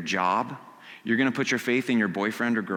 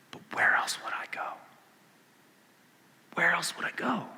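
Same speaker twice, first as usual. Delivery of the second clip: breathy, hushed whisper; Peter's whisper; 5 semitones higher, 7 dB quieter than usual. Acted. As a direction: whisper it like a private confession. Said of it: "he comes up with a big fat".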